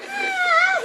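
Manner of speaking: high-pitched mock scream; imitated scream. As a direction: scream in imitation of the startled guy. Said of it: "He screamed and then".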